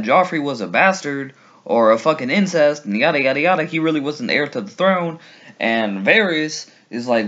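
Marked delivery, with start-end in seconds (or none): none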